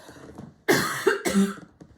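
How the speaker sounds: breathy hacking cough. Real, not acted. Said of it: "Anyway, hang on".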